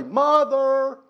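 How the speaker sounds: put-on high, loud childish call for Mother; shrill-free high cry of a child summoning help. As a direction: yell for mom like a tattling kid. Calling mother during quarrel.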